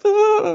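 high, loud groan of protest; mock wail. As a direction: wail it out in exaggerated dismay.

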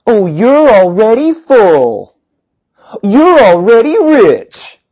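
high, slow, dripping sarcasm; sarcastic. Shown as a demonstration. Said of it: "read it with a sarcastic tone".

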